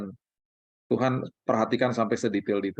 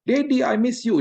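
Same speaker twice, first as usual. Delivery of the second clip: put-on high, louder little-kid voice; child's greeting; 10 semitones higher, 5 dB louder than usual. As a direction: squeal it like an excited small child. Children greeting dad home.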